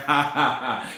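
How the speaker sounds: imitating a smug laugh; retold taunting chuckle; mocking laugh. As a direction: laugh it off like you don't care. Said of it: "And he laughing, saying".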